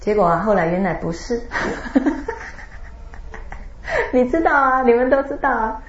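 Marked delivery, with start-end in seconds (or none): 1.5-4.1 high airy chuckle rising; laughing at himself; soft breathy giggle; real laughter. Real, not acted. Speaker laughs at own story.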